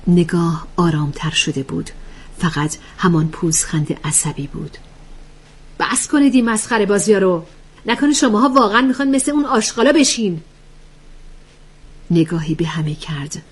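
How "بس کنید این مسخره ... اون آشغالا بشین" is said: mildly heated, higher-pitched reproach; annoyed scolding. Acted. Annoyed woman telling the group to stop fooling around, mildly heated. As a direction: scold them, pitch raised and quickening.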